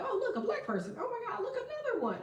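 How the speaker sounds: high, squeaky mock amazement; mock surprise. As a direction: gasp it out like a wide-eyed tourist. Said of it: "I'm like".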